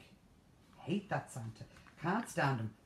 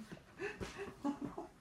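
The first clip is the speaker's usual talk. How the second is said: breathy laugh; 6 dB quieter than usual. Real, not acted. Laughing at her own joke.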